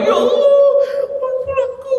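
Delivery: very high mock wail; pretend crying. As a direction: fake a tearful plea for forgiveness. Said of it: "Ya, belum nangis belum nih".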